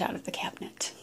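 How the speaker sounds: hushed unvoiced whisper; whispered note that Yoda jumped out. Real, not acted. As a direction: whisper so the cat won't hear. Whispering about the cat.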